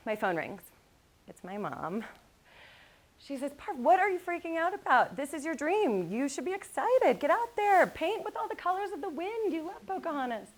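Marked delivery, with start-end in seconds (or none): 3.5-10.5 high, bright motherly scolding, lightly voiced; a hint of a pushy mom; mom's pep talk. Mother cheering her anxious daughter on, lightly voiced.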